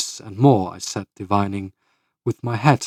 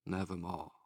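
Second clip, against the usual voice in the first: low, hushed, final; raven's reply; 3 semitones lower, 18 dB quieter than usual. A narrator's reading. Raven answers the pleading narrator, low and hushed. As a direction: let the bird's word sink softly. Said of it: "Quoth the raven".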